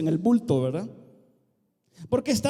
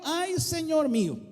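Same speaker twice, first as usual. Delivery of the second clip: whining lament; 8 semitones higher than usual. Acted. Gideon's lament, acted by preacher.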